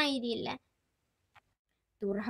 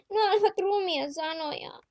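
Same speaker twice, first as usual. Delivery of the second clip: put-on crying child; 9 semitones higher than usual. Child cries after harsh words.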